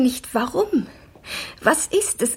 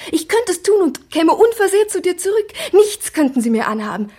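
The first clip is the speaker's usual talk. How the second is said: higher and louder, bursting out with desperate conviction; fevered outburst; 8 semitones higher, 6 dB louder than usual. Acted. Speaking to her lover, swearing she would come back unharmed, loud fevered outburst. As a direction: throw it out as a defiant vow.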